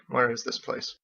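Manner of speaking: ordinary delivery, nothing marked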